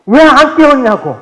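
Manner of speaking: high, loud furious yell at a parent; angry outburst. Acted. Child yelling at parent about waking late, furious. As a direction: blow up at mom for not waking you. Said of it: "갑자기 화를 버럭 나".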